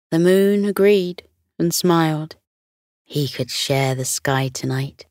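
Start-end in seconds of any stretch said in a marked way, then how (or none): none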